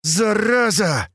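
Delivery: slow, high angry growl. A narrator's reading. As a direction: snarl the curse out slowly. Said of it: "натурально прорычала Катя".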